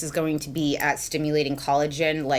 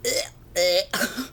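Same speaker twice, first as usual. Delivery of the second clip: disgusted groan rising up high; grossed-out ugh; 4 semitones higher than usual. Retold or imitated. Recalling touching sour cream with her nail, grossed-out groan. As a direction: squirm at mushy food on a nail. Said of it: "I was like".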